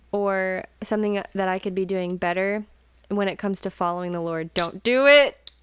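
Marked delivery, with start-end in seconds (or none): 4.5-5.5 sudden loud joking warning; playful warning. Real, not acted. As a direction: call it out to tease him. Playful call-out mid-sentence.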